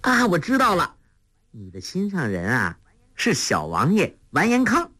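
excited, high-pitched guess; eagerly cutting in. A narrator's reading. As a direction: burst in with the answer, pitch swooping up. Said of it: "黄蓉抢着说". (兴奋地抢着说)

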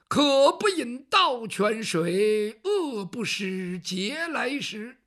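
very slow, measured scholar's recitation; a poor scholar declaiming his principles slowly; lofty declaiming. Acted. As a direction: pronounce each word with pride.

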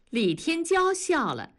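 ordinary delivery, nothing marked

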